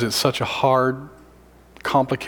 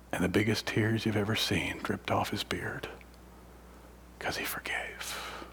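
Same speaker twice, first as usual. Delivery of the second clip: hushed narration; 10 dB quieter than usual. Real, not acted. Preacher describing the inmate's tears, whispered. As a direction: drop to a moved whisper.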